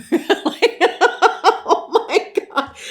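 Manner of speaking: breaking up into high laughter mid-sentence; can't get the words out for laughing. Real, not acted. To viewers over a funny book photo, laughing helplessly.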